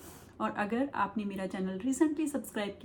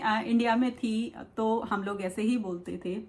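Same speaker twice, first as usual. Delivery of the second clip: normal talking voice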